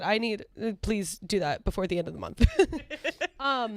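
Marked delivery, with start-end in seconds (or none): 2.5-3.3 short jittery giggle; nervous laugh. Real, not acted. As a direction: laugh it off, a little uneasy. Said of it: "This nervous laugh".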